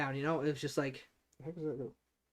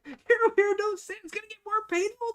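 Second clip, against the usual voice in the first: laughing plea; 4 dB louder than usual. Real, not acted.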